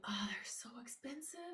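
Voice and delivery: unvoiced whisper of a stingy complaint; whispered grumble. Shopper grumbling to herself at the berry shelf, whispered. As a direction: hiss it like a shopper begrudging the price.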